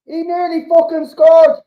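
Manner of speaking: high, loud exclaim; excited disbelief. Real, not acted. Fan reacting to a near miss, high excited outburst. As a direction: burst out at the near goal.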